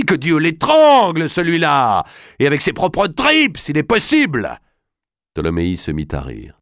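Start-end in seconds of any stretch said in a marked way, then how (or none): 0.0-4.6 loud, high shouted oath; furious curse. A narrator's reading. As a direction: spit out a curse at his enemy. Robert curses his enemy.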